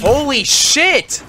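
high, drawn-out yell; loud high outburst of shock; shocked shout. Real, not acted. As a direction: yell it at full volume, stretched out. Suddenly attacked in the game.

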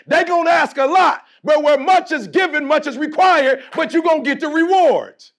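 rising preacher cadence, driving the refrain home; preaching. Acted. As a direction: preach it to the players like a pulpit. Pep talk refrain to team.

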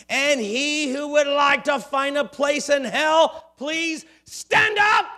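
loud, rising preacher's shout. Acted. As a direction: bellow it at the whole church. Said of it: "he shouted".

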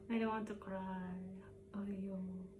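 whispered, fighting tears; holding back tears. Real, not acted. Vlogger whispering to viewers while holding back tears. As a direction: hush the words to keep from crying. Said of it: "parang naiiyak ako".

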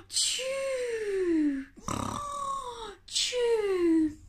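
rasping in-breath, high falling "chew"; loud storybook snore with whistled out-breath; comic snoring. Acted. Missus Bear snores beside her sleepless husband, comic snore. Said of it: "Missus Bear began to snore".

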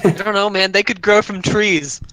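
high teasing voice. Pretend. Player teasing, high put-on voice.

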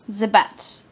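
lightly put-on accent on "The bat"; mock accent. Vlogger repeating a phrase in a put-on accent. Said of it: "I don't know about that accent".

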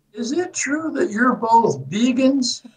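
slow, high Southern lady voice, imitated; drawn-out, put-on Southern question; thick Southern accent. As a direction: mimic an old Southern woman prying. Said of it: "with a thick Southern accent".

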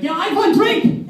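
high, slow, loud crowd yell; passengers shouting. Acted. Whole plane yelling for drinks, high and loud. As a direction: roar it back like a rowdy cabin.

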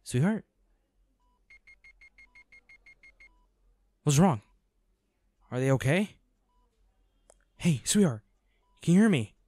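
high, urgent worried calls; anxious calling. Acted. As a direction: call out to them, scared they're not okay. Partner wakes, frightened calling out.

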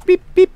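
high, rapid little beeps; squeaky voiced beep-beep; beeping noises. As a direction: imitate a tiny horn or alarm. Makes beeping noises while playing.

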